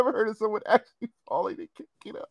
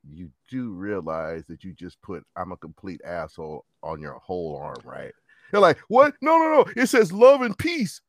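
imitating; 12 semitones lower, 4 dB louder, slower than usual. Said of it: "when Chinese people go".

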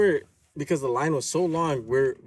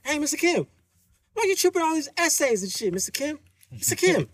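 high teasing call to Mister Kim; mocking call-out; 12 semitones higher than usual. The man in line teasing the owner, high mocking taunt. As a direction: taunt him in a high singsong. Voicing the man who defended them.

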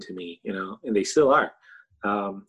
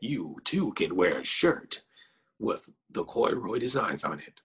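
fighting game announcer. Pretend. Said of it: "the whole announcer voice going on there".

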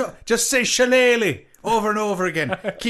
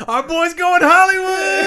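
high, loud mock celebration; excited whoop; 10 semitones higher, 7 dB louder than usual. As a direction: shout it like a proud hometown pal. Mock celebrating friend's movie job.

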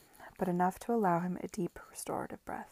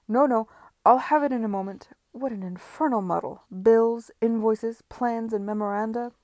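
louder, harried muddle of a man; flustered gentleman; 3 semitones higher, 11 dB louder than usual. Acted. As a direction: sputter over the piles of papers. Mr Parker flustered in his mess.

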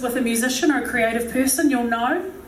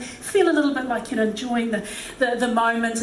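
ordinary talk

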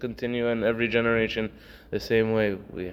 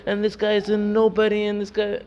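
much higher, louder outraged shouting; mock furious yell; 11 semitones higher, 4 dB louder than usual. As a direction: act out the insulted man losing his temper. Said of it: "he started yelling".

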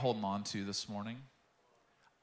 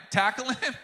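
laughing; 13 semitones higher, 7 dB louder than usual. Real, not acted. Laughing at own joke.

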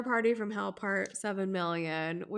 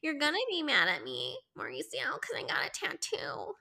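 imitating Kyle; 4 dB quieter than usual. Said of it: "is like".

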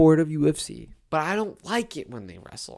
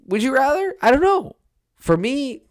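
pitched up and animated; excited high rant; 12 semitones higher, 6 dB louder than usual. Real, not acted. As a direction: voice jumps way up, loud.

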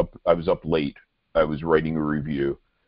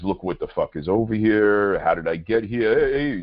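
put-on louder, riled New York Italian accent; Italian-American tough guy; 4 semitones higher, 3 dB louder than usual. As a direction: gripe like an indignant Italian-American. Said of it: "Can't do an Italian accent".

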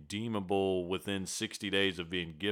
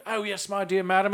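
put-on English accent; 9 semitones higher, 5 dB louder than usual. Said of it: "English accent".

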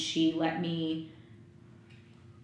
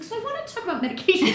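high, fast, chirpy therapist voice; mock therapist; 6 dB louder, much faster than usual. Therapist opening the session, in a light mock voice. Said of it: "she's like".

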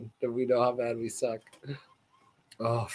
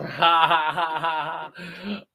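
burst of laughter; 2 semitones higher, 3 dB louder than usual. Real, not acted. Laughing at the reveal.

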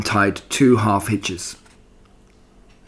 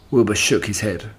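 usual speaking voice

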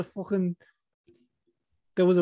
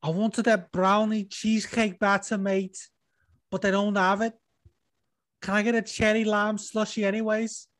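Liverpool fighter ordering fast food, building up; Darren Till ordering; 7 semitones higher than usual. Retold or imitated. Host as Darren Till ordering at the Dairy Queen drive-thru. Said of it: "here he is at the fucking drive-thru".

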